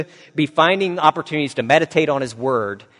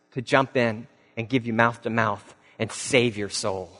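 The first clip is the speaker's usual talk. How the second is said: ordinary talk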